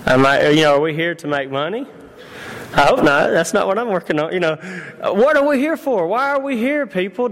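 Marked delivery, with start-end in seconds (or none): 5.0-7.3 rising appeal. Real, not acted. Preacher calls out to congregation.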